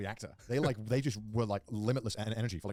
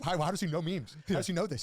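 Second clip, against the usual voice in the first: put-on baffled kids, pitched up; higher retold kid chatter; lightly voiced kids; 6 semitones higher than usual. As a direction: imitate puzzled schoolkids in a higher voice. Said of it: "'cause they were like".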